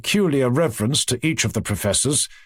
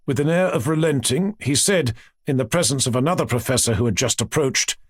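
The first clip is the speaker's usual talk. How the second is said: usual speaking voice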